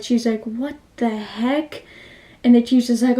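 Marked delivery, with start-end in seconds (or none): 0.4-1.8 slow, hushed mock shock; retold terrified disbelief. Mitsuko reacting to the teacher killing students, retold terror. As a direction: draw it out softly, aghast. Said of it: "like, terrified".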